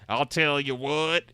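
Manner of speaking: put-on Southern accent. Streamer doing Southern speak for a drinking-game joke, hammed-up Southern accent. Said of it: "Every time I speak in Southern speak".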